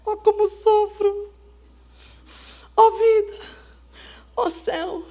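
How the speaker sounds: wallow theatrically, mocking the whiner; mock lament. Pastor mocking a self-pitying believer, soft moan.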